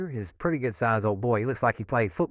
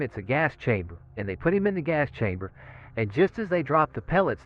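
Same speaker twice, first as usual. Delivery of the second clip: usual speaking voice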